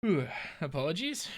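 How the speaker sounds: stretched yawn through the words. Real, not acted. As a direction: talk through a big yawn. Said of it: "I'm already yawning".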